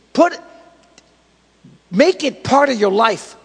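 loud, emphatic sermon exhortation; forceful preaching. Real, not acted. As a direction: drive each phrase home to the congregation.

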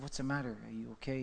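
softly whispered worried question; hushed concern. Retold or imitated. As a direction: ask it under your breath, concerned. Quiet concerned question to a friend.

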